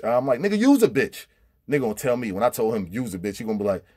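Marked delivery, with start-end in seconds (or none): 0.4-1.3 high, loud snap back; sharp shouted comeback; shouted retort. Retold or imitated. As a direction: fire the insult right back at him. Retorting in a prison quarrel.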